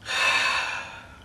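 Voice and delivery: long unvoiced sigh; a weary breathy sigh. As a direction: let out a heavy sigh. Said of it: "she sighed".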